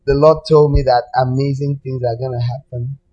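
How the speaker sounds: normal talking voice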